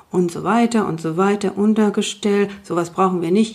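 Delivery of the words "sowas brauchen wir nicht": ordinary delivery, nothing marked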